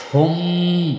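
"হুম" with a long nasal hum on the closing m; obligatory ghunnah. Shown as a demonstration. Teacher showing students wajib ghunnah, "হুম" held in a nasal hum. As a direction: hold the humming m in "হুম". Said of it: "এ জায়গা ওয়াজিব গুন্না".

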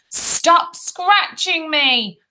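high, drawn-out protest; high-pitched plea to stop; crying out. A narrator's reading. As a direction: wail it out slowly as a tormented boy. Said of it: "cried Ruskin".

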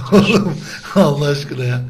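high, laughing plea. Real, not acted. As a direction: beg through laughter. Laughing, begging friend stop.

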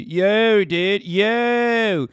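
high, loud mock Baltimore accent; Baltimore-Philly accent. Shown as a demonstration. Streamer showing how the O's sound, mock Baltimore-Philly accent. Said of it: "Rolling your fucking O's like this".

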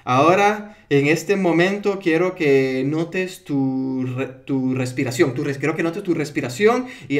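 slow, halting script reading with stumbles; mala lectura de guion. Shown as a demonstration. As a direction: read haltingly, losing your place. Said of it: "no podemos hacer hipnosis de esa manera". (lectura lenta y trabada de un guion)

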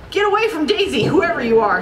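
high-pitched urgent order; storybook hero's warning, moderately forceful; commanding. A narrator's reading. Donald protects Daisy from the ghost, commanding.

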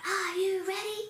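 high echoing group call; kids calling out. Retold or imitated. Kids answering the teacher's call, imitated high shout. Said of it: "And the kids call out".

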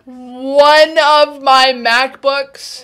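slow, loud disbelief; incredulous echo. Real, not acted. Reactor echoing the video's MacBook claim, incredulous. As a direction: draw it out loudly as a stunned question.

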